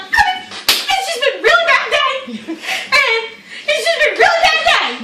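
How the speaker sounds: very high, loud, overwrought complaint; mock hysterical wail. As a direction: imitate a friend melting down in front of police. Friend wailing excuse to cop.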